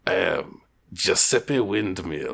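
put-on low, slow, soft Eastern European accent. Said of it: "some sort of Eastern European accent".

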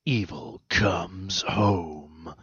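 slow, drawn-out movie trailer announcer. Pretend. Pitching a holiday horror trailer, slow announcer voice. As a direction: draw it out like a horror trailer tagline. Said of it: "it's like".

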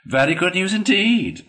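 high, loud, sceptical agreement; drawn-up, doubtful "indeed"; dubious Rat. A narrator's reading. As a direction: agree while plainly doubting it.

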